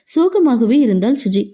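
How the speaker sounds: usual speaking voice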